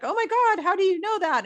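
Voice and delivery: high, loud astonished gasp of a quote; amazed client. Retold or imitated. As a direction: sound stunned as if a stranger read your past. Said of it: "And they're like".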